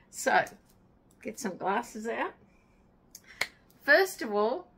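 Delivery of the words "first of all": excited. Real, not acted. Announcing happy mail excitedly.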